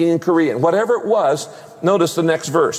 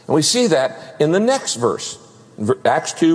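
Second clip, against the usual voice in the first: ordinary talk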